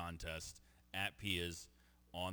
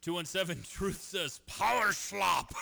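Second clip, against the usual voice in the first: mock reading of a text; 6 semitones higher, 7 dB louder, slower than usual. Host reads joke text.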